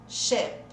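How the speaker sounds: Sheep said short, like "shep" with closed ê; short closed vowel. Shown as a demonstration. Teacher lets students check the second word, short ê vowel. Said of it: "Coloca o som de E".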